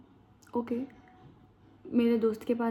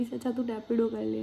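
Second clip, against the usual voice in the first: usual speaking voice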